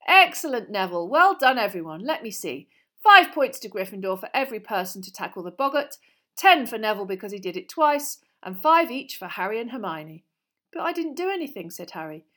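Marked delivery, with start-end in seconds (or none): 0.0-10.2 loud, lively praise to the class; projected congratulatory classroom voice; pleased teacher. A narrator's reading. Teacher praises and awards points. 10.7-11.7 higher, quick objection; surprised, hurried schoolboy voice; protesting boy. A narrator's reading. Boy protests undeserved points.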